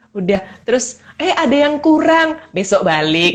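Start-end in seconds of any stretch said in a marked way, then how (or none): 1.1-3.4 casual. Retold or imitated. Retold carefree shopping of old.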